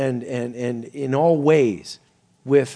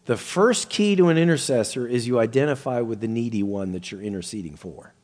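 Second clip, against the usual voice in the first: usual speaking voice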